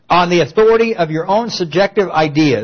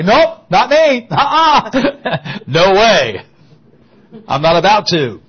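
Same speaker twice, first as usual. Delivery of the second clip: put-on higher, slow, laughing teen's quick denial; mock teen denial; 3 semitones higher, 4 dB louder, slower than usual. Preacher mocks a scared teen's denial, high and laughing. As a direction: play a scared kid swearing it isn't him.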